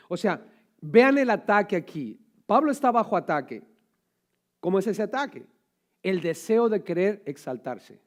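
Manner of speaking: loud, urgent sermon delivery; preaching at full force. Acted. Preacher warning the church about pride, loud preaching. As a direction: hammer the point home.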